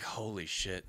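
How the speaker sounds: low and soft; stunned hush. Retold or imitated. Staring at the shirtless boy, a low soft aside. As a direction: breathe it out in shock.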